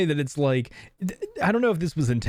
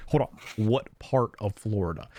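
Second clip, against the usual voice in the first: mock Southern voice; 7 semitones lower, 4 dB quieter than usual. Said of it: "He's like".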